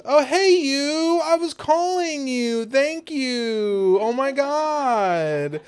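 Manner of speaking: high, slow mock-sweet Thor voice; Thor imitated. Imitating Thor sweetly greeting Stormbreaker. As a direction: coo it up high like a doting lover. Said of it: "He goes".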